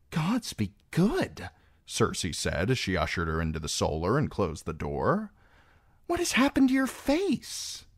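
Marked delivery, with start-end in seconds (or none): 0.1-1.5 high, slow exclamation of dismay; shocked. A narrator's reading. Queen shocked at the battered face. 6.1-7.9 very high-pitched, shocked question; appalled. A narrator's reading. Queen shocked at the battered face.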